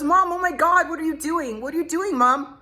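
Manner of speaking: high, urgent imitation of son; alarmed teenage son. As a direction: protest like a panicking teen. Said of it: "my son goes".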